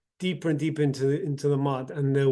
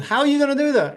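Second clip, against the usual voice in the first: high, loud disbelieving question; pitched-up mock disbelief; incredulous; 10 semitones higher, 6 dB louder, much faster than usual. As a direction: ask it as if it cannot possibly work. Astonished rich man questions peasant.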